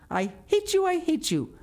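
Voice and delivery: very high, passionate cry of hatred; high-pitched child's tantrum; hateful outburst. A narrator's reading. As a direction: hurl each repetition like a stamp of the foot. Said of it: "each assertion of hatred".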